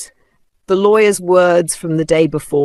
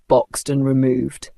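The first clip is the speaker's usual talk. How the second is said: plain reading voice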